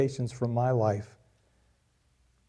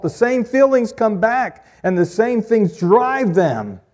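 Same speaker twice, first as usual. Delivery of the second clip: higher and louder, driving the point home; rising preaching intensity; 9 semitones higher, 6 dB louder than usual. Real, not acted. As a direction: build up to a passionate climax.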